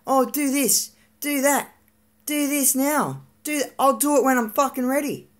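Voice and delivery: mock bossy nagging; mimicked nagging. Retelling dad's repeated nagging orders.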